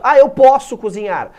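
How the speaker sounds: imitating a learner. Teacher imitates a student's first translation, loud and eager. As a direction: blurt it out like a confident student. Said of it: "Muita gente vai traduzir".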